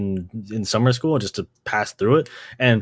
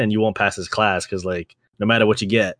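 ordinary delivery

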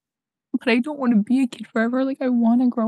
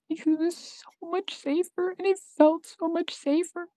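crying; 6 semitones higher, 6 dB quieter than usual. Real, not acted. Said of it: "I'm crying".

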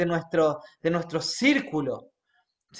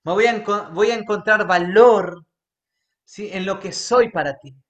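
declaring prayer. Acted. Preacher leads congregation declaring to God, earnest. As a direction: lead the church in a spoken prayer. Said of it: "quiero que declares esto conmigo".

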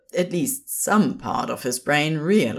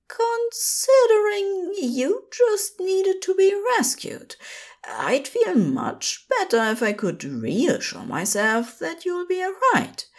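much higher, prim and flattered; gently scold, then preen; fussy Aziraphale; 13 semitones higher than usual. A narrator's reading. Aziraphale brushing off Crowley's self-criticism, high and prim.

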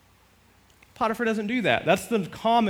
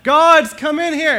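mock cry for help; 12 semitones higher, 14 dB louder than usual. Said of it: "What am I gonna say?".

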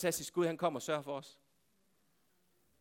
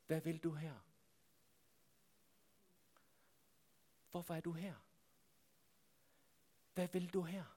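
hushed questioning; 2 semitones lower, 15 dB quieter than usual. Acted. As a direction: let the question land quietly, with long pauses. God questions Elias, asked softly.

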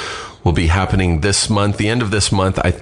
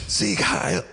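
imitated yelling; 13 semitones higher, 6 dB quieter than usual. Retelling her friend's audition in the apartment, mock yelling. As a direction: imitate a yelled salute at conversational volume. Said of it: "he's in my fucking apartment yelling".

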